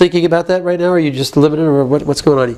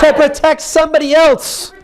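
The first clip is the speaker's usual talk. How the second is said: higher-pitched angry protest; modelled outrage; 6 semitones higher than usual. Shown as a demonstration. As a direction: keep the accusation driving on.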